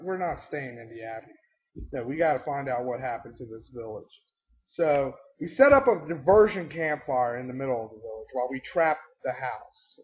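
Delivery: ordinary talk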